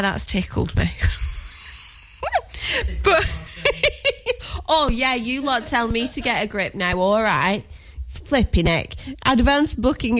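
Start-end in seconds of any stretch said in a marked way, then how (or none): 3.3-4.6 squeaky helpless giggle; high-pitched burst of laughter. Real, not acted.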